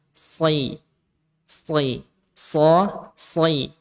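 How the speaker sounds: "si" and "so" with a heavy dark s; thick s in "si", as heavy as in "so"; thick shad. Shown as a demonstration. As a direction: isolate "si", keeping the s full-mouthed. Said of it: "huruf so ketika kasrah jadi tetap tebal".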